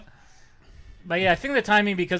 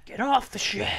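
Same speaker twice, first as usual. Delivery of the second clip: yelled movie quote; 8 semitones higher than usual. Retold or imitated. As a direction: shout it like the movie character. Yells movie line in character.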